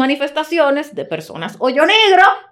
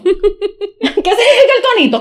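laughing demand; 6 semitones higher than usual. Acted.

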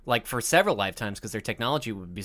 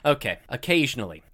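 ordinary talk, nothing marked

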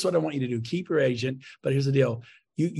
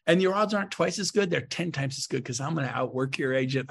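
ordinary talk, nothing marked

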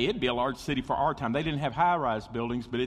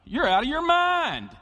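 mocking retort; 12 semitones higher, 6 dB louder than usual. His reaction to the street preacher.

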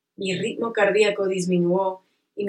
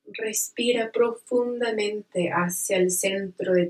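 slow, soothing meditation guide; calm guided relaxation voice. Acted.

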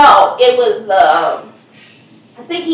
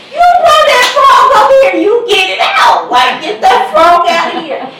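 much higher, louder scolding yell; yelling at son; 6 semitones higher, 5 dB louder than usual. Retold or imitated. Mom yelling down at her son about the frog, a loud scold. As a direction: yell at him to get the frog out.

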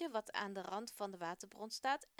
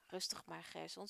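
calming; 4 semitones lower, 6 dB quieter than usual. Acted. Father calms his son.

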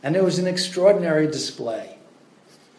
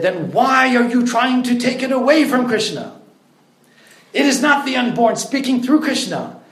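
higher, louder, angry correction; forceful rebuke; 8 semitones higher, 5 dB louder than usual. Retold or imitated. Prabhupada rebukes the student after slamming the table, angry. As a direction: slam the point home with force. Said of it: "speak with force. You might even say anger".

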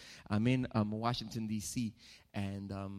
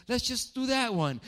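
put-on stubborn old man, higher and louder; high mock dad voice; 13 semitones higher, 7 dB louder than usual. Preacher imitating his father insisting on the building, high and loud.

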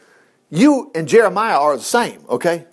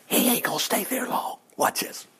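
softer, unvoiced whisper of a secret; 3 semitones higher, 9 dB quieter than usual. Real, not acted. Preacher whispering to the congregation about Jeremiah's rescue, hushed. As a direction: lean in and whisper the tease. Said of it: "preacher drops to a whisper".

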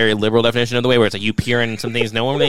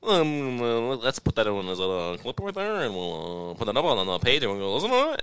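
dopey put-on loser voice, pitch swooping up and down; mocking loser; 3 semitones lower, 7 dB quieter than usual. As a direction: imitate a dull clip-poster, hesitant and softer. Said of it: "you just became that fucking total loser".

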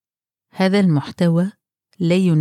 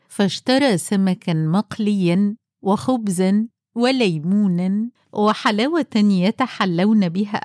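usual speaking voice

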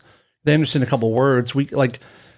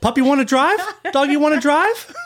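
higher, laughing puppy voice; playful baby talk to a dog; 11 semitones higher than usual. Pretend. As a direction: coo at the dog like it wants the wheel. Talking to a dog.

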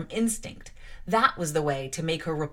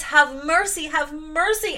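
retold plea; 8 semitones higher, 6 dB louder than usual. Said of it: "I cried out while suffocating".

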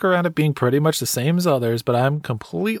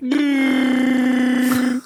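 big laugh; 8 semitones higher than usual. Real, not acted. Big laugh at listener tweet.

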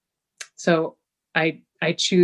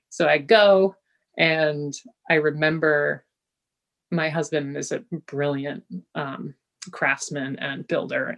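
usual speaking voice

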